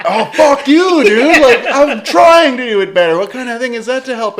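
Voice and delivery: high, loud mock-furious outburst; frustrated. Drummer snapping at his patient producer, loud high frustrated rant. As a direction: explode in comic exasperation. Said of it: "I'm frustrated and I'm trying".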